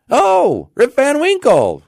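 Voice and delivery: high, loud shout of recognition; crying out. A narrator's reading. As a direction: call it out like townsfolk in a crowd. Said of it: "cried two or three".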